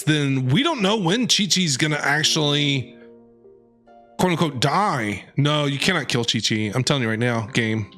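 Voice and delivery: ordinary talk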